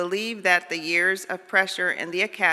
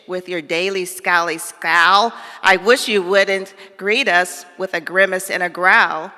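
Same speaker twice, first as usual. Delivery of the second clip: louder, pushed jellyfish voice; jellyfish complaint; 8 dB louder than usual. Acted. Jellyfish scolding the fish, louder and pushy. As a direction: complain with a bit of grumble.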